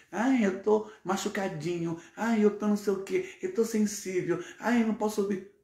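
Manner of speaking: voz aguda e manhosa de mimimi, imitando; "Ai, eu tô machucadinho" em tom de deboche, agudo; filho chorão imitado. Retold or imitated. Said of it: "sem mimimi". (imitando com deboche o filho que se faz de vítima)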